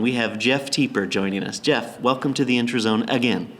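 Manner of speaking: ordinary talk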